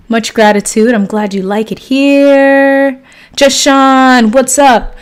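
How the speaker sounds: loud, lifted greetings to the chat; loud welcome with stretched phrase endings; enthusiastic shout-out. Real, not acted. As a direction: call out each viewer with big energy.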